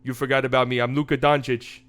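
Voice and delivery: low, cocky star-player voice; Luka Doncic. Acted. Superstar boasting as he brings the ball up, cocky impression. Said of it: "Luka Doncic comes down the floor".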